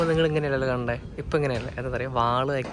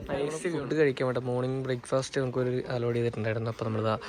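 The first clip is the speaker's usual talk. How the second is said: ordinary talk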